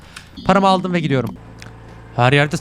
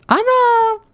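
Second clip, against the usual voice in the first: very high-pitched startled squeal; sudden drawn-out high scream; much slower than usual. Real, not acted. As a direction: shriek it out far above your usual pitch. Startled by a scare in game.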